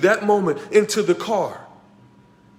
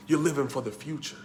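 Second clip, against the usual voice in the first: normal talking voice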